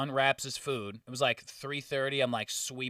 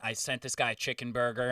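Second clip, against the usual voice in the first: usual speaking voice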